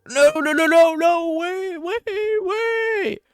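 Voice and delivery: high, loud mock-panicked wailing; frantic falsetto begging for mercy; panicked pleading. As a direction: squeal it out, stretching the last words. Acting a panicked victim.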